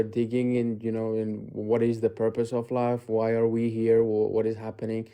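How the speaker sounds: ordinary talk, nothing marked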